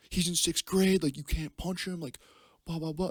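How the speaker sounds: high-pitched mock parental protest; scolding dad impression. Dad objecting to his son's punch, high mock scolding. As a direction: imitate an appalled dad in a high voice.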